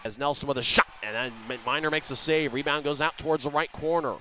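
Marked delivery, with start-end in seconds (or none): 0.0-2.6 quick steady play-by-play; rapid hockey play-by-play. Real, not acted. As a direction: call the action as it happens. Live play-by-play.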